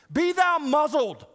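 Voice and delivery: loud, high, slow King James rebuke; hammed-up old-English command to shut up; preacher suggesting the congregation try it, booming; mock solemn command. Shown as a demonstration. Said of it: "if you'd like to tell someone to be silent".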